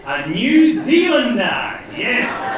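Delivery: slow, high, loud triumphant answer; triumphant reveal. Real, not acted. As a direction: announce the answer proudly to the crowd. Reveals punchline to audience question.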